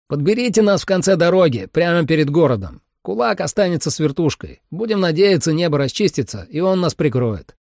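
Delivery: high, urgent radio orders; pressing, high-pitched field dispatch; team leader on radio. Acted. Narrator voices radio orders.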